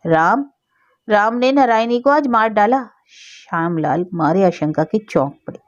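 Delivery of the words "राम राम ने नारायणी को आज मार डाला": ordinary delivery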